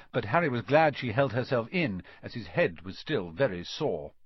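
ordinary delivery, nothing marked

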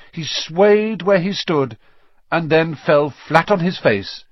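ordinary delivery, nothing marked

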